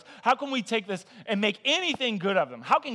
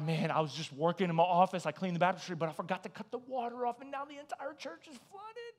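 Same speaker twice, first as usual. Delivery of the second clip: starts plain, then climbs higher and fades much softer; voice rising in fear; 5 dB quieter than usual. Retold or imitated. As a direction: let the panic push the voice up while it shrinks to almost nothing. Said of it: "my voice gets higher, and I get quieter".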